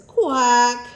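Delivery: loud, sudden duck call; duck quack. A narrator's reading. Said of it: "Look at the ducks. It says".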